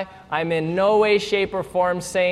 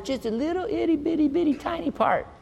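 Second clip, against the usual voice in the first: tiny-voice emphasis; 8 semitones higher, 4 dB quieter, faster than usual. Acted. Minimizing tiny part.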